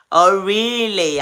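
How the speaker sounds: ordinary talk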